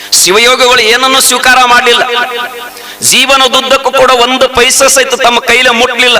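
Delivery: high, ringing sermon voice; fervent village preacher; preaching at full force. Acted. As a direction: preach it out to the crowd.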